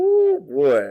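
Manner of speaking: high, loud delighted whoop; thrilled to see him; excited whoop. Real, not acted. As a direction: greet him with a loud burst of joy. Excited greeting of guest.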